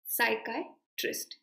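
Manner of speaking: साइकाइट्रिस्ट broken as "sai-kai", pause, "trist"; syllable-by-syllable pronunciation. Shown as a demonstration. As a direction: model साइकाइट्रिस्ट in separated parts for note-taking. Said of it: "ये note करो pronunciation". (साइकाइट्रिस्ट को तोड़कर, "साइकाइ... ट्रिस्ट")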